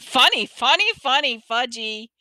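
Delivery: put-on high sing-song little-kid voice; gleeful toddler. A narrator's reading. Toddler echoing his mother's scolding, gleeful. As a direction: pipe it up, then come down.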